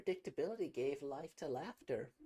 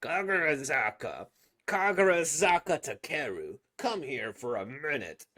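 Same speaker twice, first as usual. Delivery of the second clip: calling out; 10 dB louder than usual. Acted. Teacher calling student.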